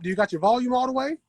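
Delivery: ordinary talk, nothing marked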